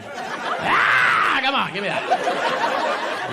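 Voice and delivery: high scream into drawn-out pleading; playful yell. Pretend. Comic goading an audience member to join in, yelling. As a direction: screech it, then coax.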